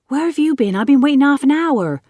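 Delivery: put-on high, loud boyish complaint; loud, put-out scolding with a casual accent; indignant cry. A narrator's reading. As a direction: cry out impatiently at a latecomer. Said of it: "cried Jack".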